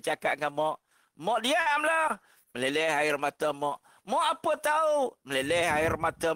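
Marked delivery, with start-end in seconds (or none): 1.1-2.2 put-on rude child. Child snapping at mother. 4.0-5.1 put-on rude child. Child retorting to mother.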